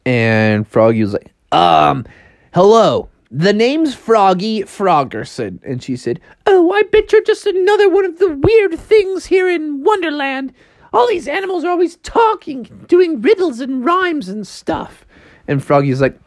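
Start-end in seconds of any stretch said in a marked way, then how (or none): 1.5-5.6 raised, hesitant greeting with stretched name; lightly voiced frog saying hello; frog introducing himself. Froggy introduces himself to Alice. 6.5-15.1 put-on very high-pitched girl's voice, fed up; high, weary grumbling about weirdness; exasperated Alice.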